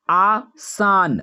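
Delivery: आसान stretched very slowly, every sound held; drawn out. Shown as a demonstration. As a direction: draw out आसान syllable by syllable for the class. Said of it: "teacher says the word slowly". (आसान को बहुत धीरे खींचकर बोलें)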